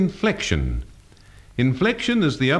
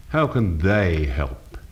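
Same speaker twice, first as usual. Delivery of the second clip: slow, lower sneer leaning on "they"; 4 semitones lower, slower than usual. Shown as a demonstration. Teacher showing changed mood, sneering emphasis on "they". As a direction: sneer it, drawing out "they" with a downward turn. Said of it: "becomes a sneer with inflective emphasis on the word they".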